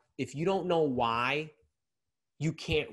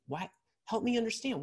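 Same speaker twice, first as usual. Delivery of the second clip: softer and higher, an inviting plea; kind, gentle asking; 7 semitones higher, 4 dB quieter than usual. Shown as a demonstration. Coach showing empathetic listening, gentle and soft. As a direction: ask as if you truly want to know. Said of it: "in the kindest, most em-, empathetic way".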